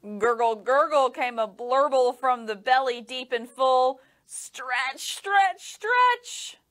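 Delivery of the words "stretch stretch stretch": rising pitch. A narrator's reading. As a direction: climb up with each word. Rising stretch sounds.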